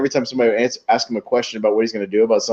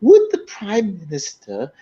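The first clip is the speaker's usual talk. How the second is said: higher, slow hammed-up backbencher; slow, high mock parliamentary ask; mock MP question; 6 semitones higher, much slower than usual. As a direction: lightly send up a pompous MP. Retelling Will Ferrell's MP questions.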